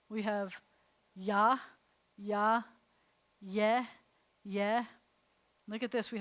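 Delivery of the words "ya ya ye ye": clear, louder sounded syllables; syllable drill. Shown as a demonstration. Teacher sounds out syllables for students to repeat. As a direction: sound each one out for the class. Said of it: "Let's practice it here".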